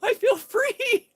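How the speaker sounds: very high and loud, slow, half laughing half crying; giddy laughing cry of freedom; laughing squeal. Retold or imitated. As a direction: shriek it through laughter. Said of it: "He's running around Walmart going".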